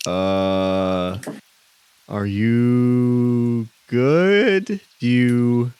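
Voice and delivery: put-on slow, hesitant old man, vowels drawn way out; baffled old man feeling for words; confused old man. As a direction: stretch the words out in bewilderment. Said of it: "a confused looking old man looking at you three, uh, two of which are blindfolded, and he says".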